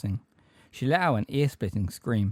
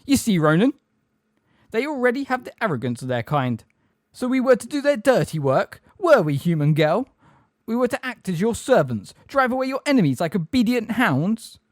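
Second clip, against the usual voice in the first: high, loud, offended tirade; furious bellow; 11 semitones higher, 6 dB louder than usual. A narrator's reading. Grey centaur to Ronan and Hermione, furious bellow. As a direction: bellow accusations at the captive. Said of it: "and bellowed".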